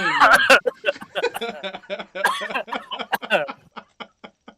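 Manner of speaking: bursting into loud laughter; big laugh breaking out. Real, not acted. Laughs after announcer demo.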